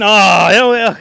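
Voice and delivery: loud, happy outburst; excited cheer. Real, not acted. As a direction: blurt it out, thrilled. Excited at finding knockoff Dunkin'.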